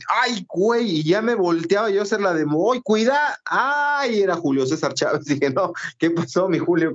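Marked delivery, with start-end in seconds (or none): 0.1-1.0 a high startled ay; startled yelp. Retold or imitated. Startled cry on bumping into a post. 2.5-4.2 high surprised ay; startled cry. Retold or imitated. Startled shout on seeing Chávez. Retells warning then recognizing Chávez.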